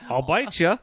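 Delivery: mock Aussie vampire threat, laughing; laughing mock-Australian vampire. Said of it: "I'm an Australian vampire".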